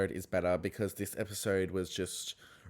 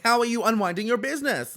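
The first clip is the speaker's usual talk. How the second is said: loud, high accusing challenge; 12 semitones higher, 8 dB louder, faster than usual. Retold or imitated. Lisa attacking Whitney, loud and high. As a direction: fire the question at her.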